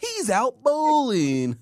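high, very slow drawn-out pundit line; repeating the pundit voice. Retold or imitated. Echoing cohost's pundit impression, high and very slow. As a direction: stretch every word mockingly. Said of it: "Why you say it like that".

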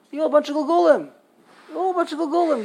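hammed-up preacher yelling at the sick; mock preacher. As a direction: parody the preacher condemning the patients. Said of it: "very easy for them to say".

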